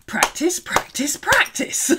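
practice, practice, PRACTICE climbing in pitch; rising emphasis. Shown as a demonstration. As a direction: build the repeats to a high peak.

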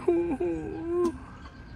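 laughing at his own joke; high laugh. Real, not acted.

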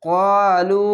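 ক্বালু with both vowels held long; drawn-out recitation. Shown as a demonstration. Teacher modelling the one-alif stretch, drawn out. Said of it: "এক আলিফ টেনে পড়বেন".

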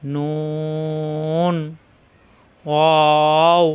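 লাম and মিম held very long and slow; drawn-out letter names. Shown as a demonstration. Teacher drawing out letter names for students to copy. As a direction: stretch each letter name out slowly. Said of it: "জিহ্বার আগা উপরে তালুতে লাগবে".

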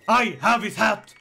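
usual speaking voice

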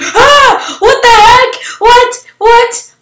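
high, loud panicked yelps; startled high cries of what; panicked shock. Real, not acted. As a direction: shriek in disbelief at the swarm. Swarmed by creepers.

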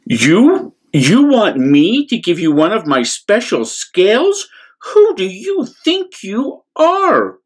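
high, haughty outraged voice; indignant cry. Acted. Rainbow Fish scornfully refusing the little fish, high and haughty. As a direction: scoff in proud disbelief. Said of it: "cried the Rainbow Fish".

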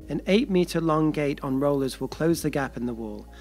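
usual speaking voice

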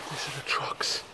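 breathy hiding whisper. Real, not acted. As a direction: whisper so no one hears. Hiding near the trucks.

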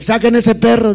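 the priest barks an order mid-prayer; shout into the microphone. Retold or imitated. Cura grita por el micrófono. (grito del cura por el micrófono)

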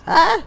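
sudden frightened shriek; startled scream. Real, not acted. Jump scare in horror game.

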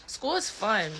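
a touch of a bright announcer voice; mock cheery. Telling kids school is fun in a mock cheery voice.

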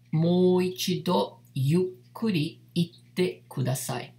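Mou ichido yukkuri itte kudasai stretched out, every syllable clear; teacher slows down so students can repeat; slow careful model. Shown as a demonstration. Teacher models the phrase slowly for students. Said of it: "como você diria".

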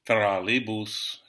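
"trolleybus" drawn out slowly, Russian-style; slow Russian-style word; slow word read. Shown as a demonstration. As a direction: stretch every syllable.

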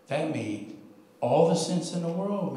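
ordinary delivery

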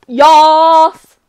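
loud, high yas; excited yas. Real, not acted. Streamer cheering Avery's win, loud yas. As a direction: belt out a delighted yas. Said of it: "I just said yas".

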